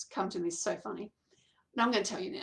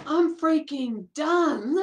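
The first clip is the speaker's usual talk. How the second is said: fed-up outburst; 5 semitones higher, 7 dB louder, much slower than usual. Retold or imitated. Fed up, finally deciding.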